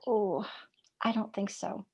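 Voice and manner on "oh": normal talking voice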